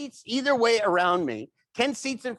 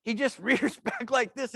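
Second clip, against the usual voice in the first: usual speaking voice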